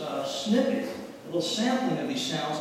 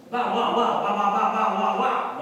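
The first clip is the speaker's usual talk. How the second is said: loud, higher yappy barks of a dog; dog barking; 4 semitones higher, 6 dB louder than usual. Retold or imitated. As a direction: bark it out like a dog. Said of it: "a dog can go".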